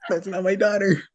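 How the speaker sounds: high-pitched awkward mock protest; awkward denial. Retelling a denial to the store lady, awkward high voice. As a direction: squeak out an embarrassed correction.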